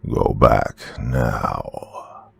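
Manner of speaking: very slow, inhuman demon command; bitter demonic tone. Acted. The demon inside orders the fleeing girl back, very slow. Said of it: "Now it was a bitter, demonic tone".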